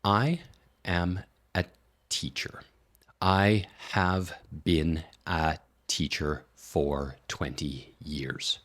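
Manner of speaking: every word cut apart, very slow; each word isolated with long gaps; extreme word-by-word. Shown as a demonstration. Teacher demonstrating choppy word-by-word English. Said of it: "in its extreme form, it's gonna sound a little bit like this".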